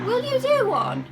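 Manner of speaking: high, loud irritated yell; annoyed shout. Real, not acted. Vlogger interrupted while talking about Nottingham, annoyed shout. Snaps at something interrupting her filming.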